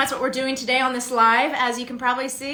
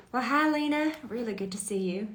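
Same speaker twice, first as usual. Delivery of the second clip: a hint of the Mary Beth character, sweet and hushed; 4 dB quieter than usual. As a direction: slip briefly into the character's greeting, then drop to a soft hush. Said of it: "Can you say my name in a Mary Beth voice?".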